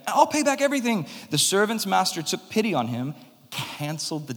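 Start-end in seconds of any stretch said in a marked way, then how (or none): 0.1-1.1 high, pleading plea for mercy; begging servant. Acted. Kneeling servant begs his master for patience, high and pleading. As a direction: beg the master, voice pitched up high. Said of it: "he begged".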